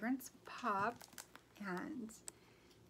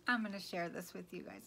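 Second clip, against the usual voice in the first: normal talking voice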